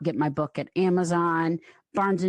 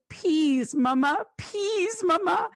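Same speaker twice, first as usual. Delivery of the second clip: much higher, imitating a little girl pleading; toddler voice; 14 semitones higher, slower than usual. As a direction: plead like her small daughter. Imitating her toddler daughter.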